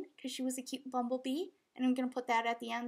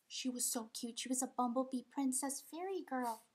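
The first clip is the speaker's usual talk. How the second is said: ordinary talk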